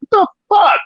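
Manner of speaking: sharp, high disbelieving yelp; incredulous outburst. Retold or imitated. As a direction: shoot way up in outrage. Handed a paper towel for after.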